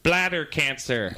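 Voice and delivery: slow, flat delivery of bladder cancer; deadpan answer. Retold or imitated. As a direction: drop it slowly and gravely. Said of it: "He's like".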